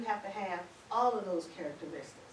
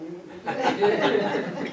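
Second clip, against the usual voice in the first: loud laugh; 12 dB louder than usual. Real, not acted. Host laughs about funny accents.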